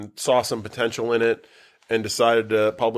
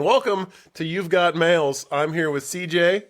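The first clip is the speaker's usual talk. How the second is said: higher, slow announcer-style welcome; host intro; 6 semitones higher than usual. Acted. Host opening the podcast for listeners, slow upbeat announcer welcome. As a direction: open the show like a radio host. Intro redone as show opener.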